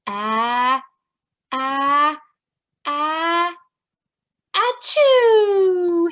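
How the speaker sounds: building ah-ah-ah into a loud achoo; comic bear sneeze, rising to a loud blast. A narrator's reading. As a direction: wind up and burst into a big sneeze. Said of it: "bear say".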